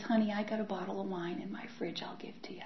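soft, hushed, kindly whisper; Richard's mother whispering kindly to her; mother-in-law offering. Retold or imitated. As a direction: offer it gently, like a secret favor. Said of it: "Richard's mother, says".

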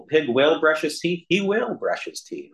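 sing-song the good brother's virtue; goody-goody pig. Retold or imitated. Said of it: "so it's like".

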